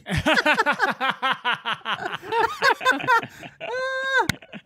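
squealing laughter; long high laugh. Real, not acted. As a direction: burst into high giggles. Laughing at the negligee line.

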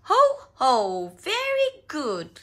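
loud, high hearty ho-ho; laughing. A narrator's reading. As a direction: chuckle out slow, booming praise. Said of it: "laughed Daddy Pig".

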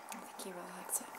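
whisper. Real, not acted. Aside about the dog.

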